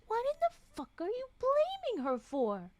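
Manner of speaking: high, incredulous protest; indignant outburst. Real, not acted. Streamer defending a character, high indignant protest. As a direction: snap back in outrage on her behalf.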